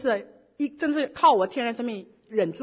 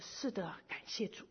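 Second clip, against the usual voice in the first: whispered thanks; 4 semitones lower, 16 dB quieter than usual. Real, not acted. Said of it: "就说".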